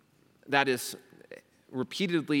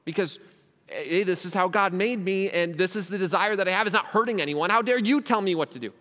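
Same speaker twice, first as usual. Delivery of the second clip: higher, louder defensive protest; defiant objector; 5 semitones higher, 5 dB louder than usual. Retold or imitated. Another critic defending their desires to the preacher, defiant and raised. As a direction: push back hard, offended and defiant. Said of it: "Someone else could look at me and say".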